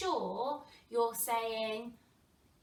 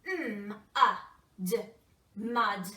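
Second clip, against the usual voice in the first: robot voice; 6 dB louder than usual. Shown as a demonstration. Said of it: "Get your robot arms".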